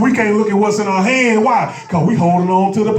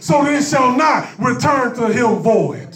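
preaching shout; 5 semitones higher than usual. Acted. Pastor preaching to the church, full shout. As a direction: ring it out from the pulpit. Preacher lands the scripture line.

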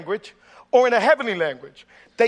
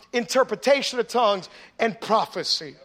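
usual speaking voice

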